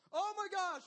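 put-on a parent seeing the child near the edge; panicked dad. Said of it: "as a dad, I'm panicking".

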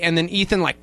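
ordinary delivery

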